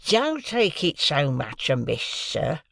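slow, drawn-out placating plea; slow character voice, appeasing; pleading keeper. A narrator's reading. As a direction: plead like a keeper soothing an angry passenger. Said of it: "pleaded the keeper".

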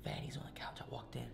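soft unvoiced whisper; whispering over a crib. Real, not acted. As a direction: whisper so nobody wakes. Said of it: "Shh, the baby's sleeping".

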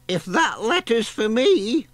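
put-on high, fluttery old lady voice; old granny voice. A narrator's reading. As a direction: play a dithery granny hunting her glasses.

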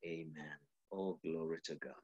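very low and very soft; hushed amen. Shown as a demonstration. Preacher demonstrating a barely audible amen. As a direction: sink to a hush on the amen. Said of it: "and I can say".

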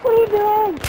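high, loud shriek; shrieking question. Real, not acted. Gamer shrieks at teammate, high and loud. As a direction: shriek it in disbelief.

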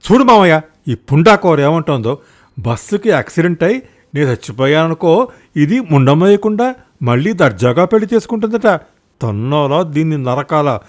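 furious husband complaining about his wife; angry complaint. Acted. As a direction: vent your outrage to the uncle. Husband complains angrily to uncle.

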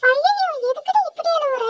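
high-pitched mild alarm; dismayed exclamation. Acted. Classmate alarmed at friend crying.

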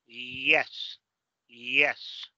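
YES with a drawn-out ee-glide at the start; YES, smooth Y with no hard J; soft Y sound. Shown as a demonstration. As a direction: model YES slowly like Spanish "ie-s". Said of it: "es el mismo sonido que utilizamos aquí".

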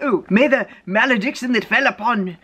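high, put-on archbishop voice; mock-grand declamation; archbishop composing a curse. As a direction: proclaim it like a pleased curse-writer. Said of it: "I wonder if he was like".

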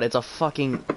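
ordinary talk

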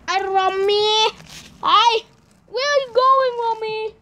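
high-pitched call after a cat; calling out to pet. Pretend.

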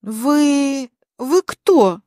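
halting, stunned question with a pause; stunned, forced out. A narrator's reading. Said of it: "выдавила она наконец".

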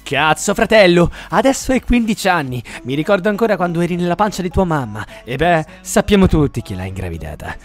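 normal talking voice

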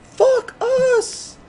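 very high, loud mock Italian accent. Classmates all mimicking the Italian teacher, shrieked accent. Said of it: "we were like".

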